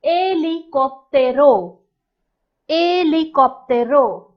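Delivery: helicóptero with stress on có, drawn out; teacher introducing the word; slow Spanish demo. Shown as a demonstration. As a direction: model helicóptero carefully. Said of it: "A helicopter is called".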